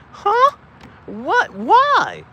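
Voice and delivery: high loud puzzled questions; mock confusion. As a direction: react baffled, high and loud. Mock reaction to shirt.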